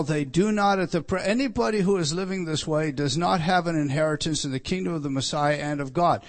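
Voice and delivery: ordinary delivery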